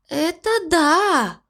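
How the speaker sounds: high, slow squeak of agreement; squeaky voice. Acted. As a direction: squeak it out unexpectedly. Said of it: "неожиданно писклявым голосом".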